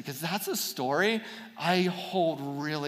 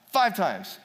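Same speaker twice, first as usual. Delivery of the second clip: ordinary delivery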